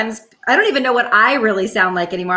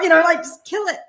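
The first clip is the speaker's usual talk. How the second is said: ordinary delivery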